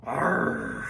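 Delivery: father groans entering room; gruff arg. A narrator's reading. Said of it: "said Stoik the Vast".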